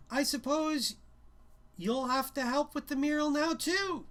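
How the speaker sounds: teacher telling the student to help with the mural; telling not asking. Acted. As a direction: make it an order dressed as a question. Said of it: "more telling than asking".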